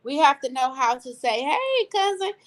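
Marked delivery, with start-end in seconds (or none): 1.4-2.3 acted friendly greeting. Acting out greeting a man.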